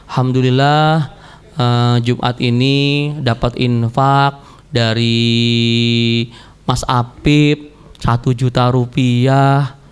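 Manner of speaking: a slow, drawn-out mosque announcer read; mosque announcement. Retold or imitated. Preacher imitating a mosque announcer, slow announcement. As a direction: announce the donation slowly over the mosque speaker. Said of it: "diumumkan di masjid".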